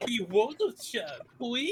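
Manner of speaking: high cutesy uwu pleading; Uwu speak. Acted. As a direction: squeak it out like an anime girl. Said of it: "unironic Uwu speak".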